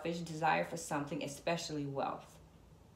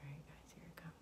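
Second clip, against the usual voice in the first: hushed whisper to the viewers; breathless whispered reveal; 17 dB quieter than usual. Real, not acted.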